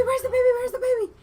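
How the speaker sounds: very high, loud mock-panic shrieking; freaking out. Retelling a mother missing her baby, high frantic freak-out. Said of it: "she starts freaking out".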